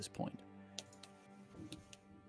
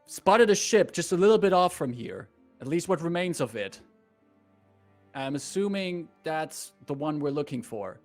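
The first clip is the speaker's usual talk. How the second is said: higher, louder armored knight; Nimbus voice; 4 semitones higher, 6 dB louder than usual. Acted. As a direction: speak up through the helmet. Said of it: "he goes".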